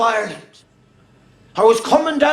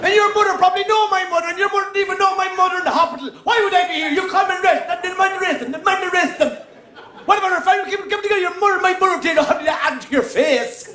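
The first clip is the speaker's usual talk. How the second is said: put-on higher, fast rambling Irish Traveller accent; 4 semitones higher, faster than usual. Comedian rants at the lads in a fast Traveller accent. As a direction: gabble on in a Traveller accent, all in. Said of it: "answered them in their accent".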